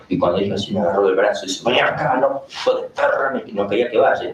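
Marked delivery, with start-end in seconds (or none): 1.6-3.3 higher, raspy-edged snarl at the priest; a demon hissing through the girl, hostile; possessed woman's demon voice. Retold or imitated. Possessed girl grabbing the priest, snarling demon voice.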